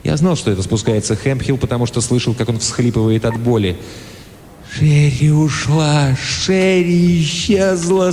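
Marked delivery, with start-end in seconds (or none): 4.7-8.1 slow, drawn-out moan, higher. A narrator's reading. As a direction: groan it out in pain. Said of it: "стонал он".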